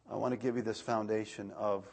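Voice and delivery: ordinary delivery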